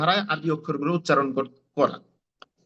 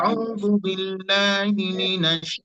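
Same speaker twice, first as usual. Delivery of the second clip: slow chanted আউযু বিল্লাহি, রাজিম drawn out long; Arabic recitation, very slow; Quran recitation; much slower than usual. Shown as a demonstration. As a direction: recite it slowly like tajweed. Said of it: "পড়ার চেষ্টা করে দেখেন".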